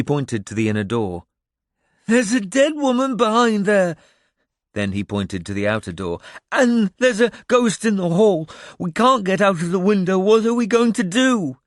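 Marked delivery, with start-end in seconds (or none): 2.1-4.0 put-on high, loud frightened boy; panicked outburst. A narrator's reading. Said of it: "before he could go off again". 6.5-11.6 put-on high, loud wail of a frightened boy; panicked outburst. A narrator's reading. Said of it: "before he could go off again".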